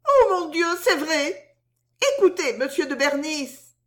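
very high maid's voice; flustered soubrette. A narrator's reading. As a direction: exclaim in a high, flustered feminine voice. Soubrette reacting in sudden concern. (soubrette affolée, voix très aiguë)

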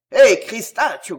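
growled oath. A narrator's reading. Concini cursing at his wife, growled oath. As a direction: growl the curse out. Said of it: "gronda Concini".

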